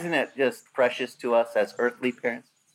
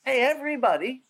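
higher pitched call to the crowd; calling out to all; 10 semitones higher than usual. Retold or imitated. Worship leader summoning everybody from far and wide, a raised call. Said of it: "He's calling out to everybody".

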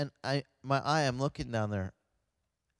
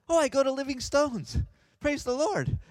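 imitated nurse, high and loud; mock-delighted high outburst; excited exclamation; 10 semitones higher, 4 dB louder than usual. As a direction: gush in a raised, high voice. Nurse delighted to meet a pastor.

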